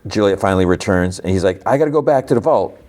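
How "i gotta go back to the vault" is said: hurried, higher-pitched retold worry; mildly anxious. Solo to Juliet as she returns, mildly anxious. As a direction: imitate him anxious, higher and quicker. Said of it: "He's getting anxious".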